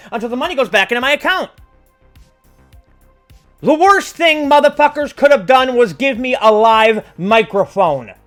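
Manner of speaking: loud, high ranting promo; wrestling promo. Acted. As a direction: rant it like a wrestler.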